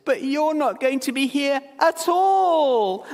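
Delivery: put-on high, slow, tearful little boy's wail; imitating a sobbing boy. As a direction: whine it like a heartbroken kid. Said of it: "he sobbed and he said this".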